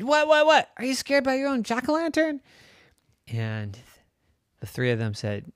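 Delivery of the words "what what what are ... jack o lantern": high, hurried questioning; alarmed daddy. Acted. Daddy running to his screaming family, alarmed.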